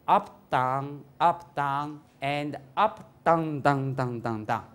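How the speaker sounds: slow, drawn-out low monotone; drawling, repetitive low count; up-and-down mind. Shown as a demonstration. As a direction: stretch each word out, then tumble downward. Mimicking the rise and fall of mind.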